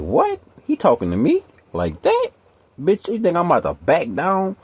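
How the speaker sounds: mock her as offended and ready to fight; imitating the woman. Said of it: "the bitch looked at me like".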